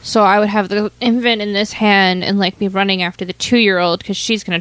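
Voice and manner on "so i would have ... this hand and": usual speaking voice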